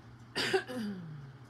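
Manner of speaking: clearing a rough throat. Real, not acted. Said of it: "there's, like, a frog in my throat".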